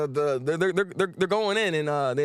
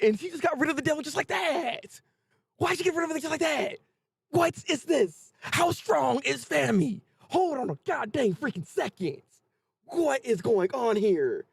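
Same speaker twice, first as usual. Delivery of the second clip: high, incredulous questioning; stunned disbelief; 7 semitones higher than usual. Real, not acted. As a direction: stretch the questions out in astonishment. Stunned reaction to devil's defeat.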